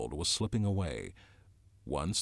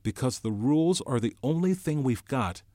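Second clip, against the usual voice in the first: raised, higher voice of pleading argument; insistent appeal; 6 semitones higher, 6 dB louder than usual. A narrator's reading. Ralph summons his wits against Jack, insistent appeal. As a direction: argue it urgently, voice lifted.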